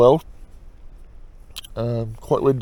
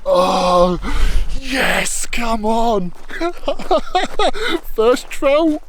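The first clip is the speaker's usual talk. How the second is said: high, joyful with a laugh; excited laughing; 9 semitones higher, 6 dB louder, much slower than usual. Real, not acted. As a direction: laugh with triumphant glee. Celebrating the released catch.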